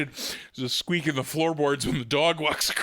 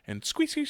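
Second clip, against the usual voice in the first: imitated squeak; 11 semitones higher, 4 dB quieter than usual.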